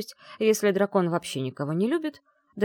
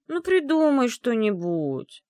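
slow, high, louder whine; whining; 7 semitones higher, 4 dB louder, slower than usual. Acted. Bride whining to her groom behind the door. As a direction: drag it out like a sulky child. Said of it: "заныла я".

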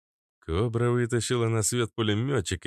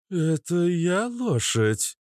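slow, high, indignant echo; offended; 10 semitones higher, much slower than usual. Acted. Cobra takes offence at being called horse, indignant. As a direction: drag it out as a wounded question. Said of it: "с обидой в голосе переспросила кобра".

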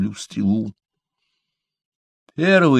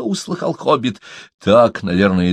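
usual speaking voice

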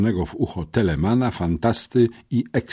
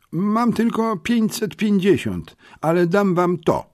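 put-on high boy voice; 13 semitones higher than usual. A narrator's reading. Smerf offers his savings.

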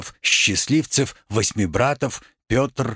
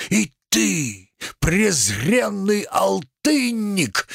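theatrical indignation; slower than usual. Acted. Tragedian exalts woman, scorns merchant.

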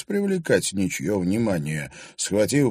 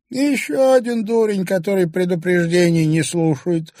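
high, louder crone voice; mumbling old crone; 10 semitones higher, 6 dB louder than usual. A narrator's reading. Old woman grumbles at the journalist, high and mumbling. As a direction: mumble it like a toothless hag. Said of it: "прошамкала Устинья".

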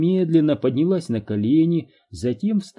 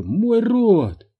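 alarmed cry; 9 semitones higher, 5 dB louder than usual. A narrator's reading. Mother's mouth burning.

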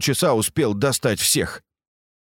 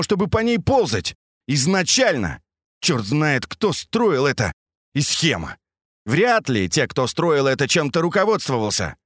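agitated rant; 7 semitones higher than usual. A narrator's reading. Sergei panics, berates Max.

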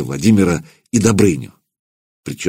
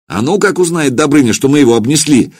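shout; 6 semitones higher, 3 dB louder than usual. A narrator's reading. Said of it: "крикнул в ответ племянник".

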